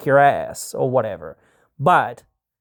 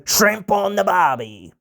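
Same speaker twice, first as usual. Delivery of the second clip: hammed-up Australian accent, pitched higher; 4 semitones higher than usual. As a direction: lay on a cartoon Australian accent. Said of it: "which we would never say".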